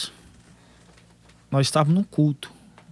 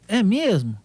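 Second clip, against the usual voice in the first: high, swooping incredulous question; imitating dad's surprised "oh really?"; father's questioning manner; 6 semitones higher, much slower than usual. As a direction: lift the pitch right up, like dad reacting. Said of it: "Meu pai falava desse jeito".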